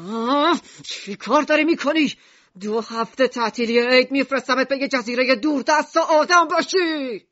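angry yell. A narrator's reading. Mishima grabs Alan and threatens exile, angry yelling. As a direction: yell it as an enraged father. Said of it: "گرفت و فریاد کشید".

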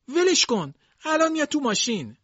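very high, strained plea; old servant begging again, strained and high; old maid pleading harder. Acted. Dilsey repeats her plea.

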